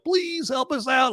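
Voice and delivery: high, drawn-out mock plea; pleading. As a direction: beg slowly in a high voice. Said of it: "trying to persuade him".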